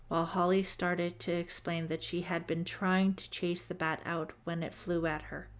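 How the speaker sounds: plain reading voice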